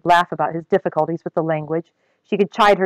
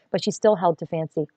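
usual speaking voice

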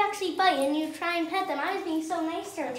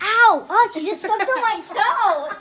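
yelp of pain; 4 semitones higher, 10 dB louder, slower than usual. Real, not acted. Toe stepped on.

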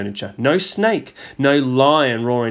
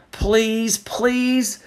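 begging; 10 semitones higher than usual. A narrator's reading. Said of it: "Judy begged".

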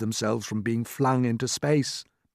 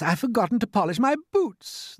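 high-pitched fretting creature voice; fussing centipede; 10 semitones higher than usual. A narrator's reading. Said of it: "the centipede said".

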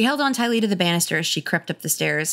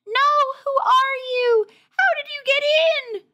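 frightened cry; 4 dB louder than usual. Acted. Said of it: "uttered a hushed cry of protest".

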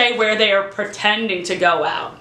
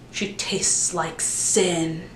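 slow, soft mock-seductive murmur; hammed-up sultry whisper; 6 semitones lower, 7 dB quieter, much slower than usual. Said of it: "he kind of is like".

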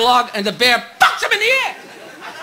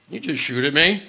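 low gruff bear voice, much lower; 12 semitones lower, 7 dB quieter than usual. Acted. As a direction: drop way down for the bear.

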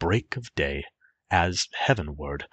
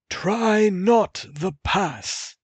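high, slow, louder urgent warning; old man warning; 6 dB louder, slower than usual. Acted. The old man warning the youth off the pass, high and urgent. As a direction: plead with him to turn back, pitched high. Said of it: "the old man said".

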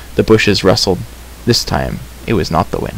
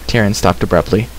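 ordinary delivery

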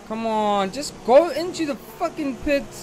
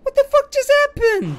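much higher, louder baffled cry; stunned outburst; 6 dB louder than usual. Real, not acted. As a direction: cry out in disbelief. Baffled after crash.

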